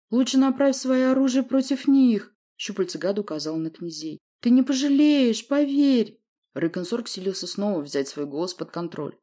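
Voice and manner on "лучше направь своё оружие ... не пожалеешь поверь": desperate pleading. Acted. Monster pleads and bargains.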